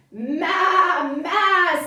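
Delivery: lightly voiced goat's maa; playful goat call; goat bleating. A narrator's reading. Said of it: "said the goat".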